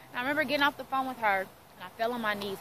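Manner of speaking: ordinary talk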